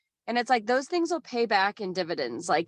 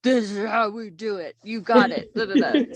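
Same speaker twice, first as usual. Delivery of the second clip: lightly voiced hard-charging Marine; a hint of a drill sergeant; gruff Marine impression; 4 dB louder than usual. Mocking how Marines talk on the audiobook, mild gruff voice. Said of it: "you have to talk all like".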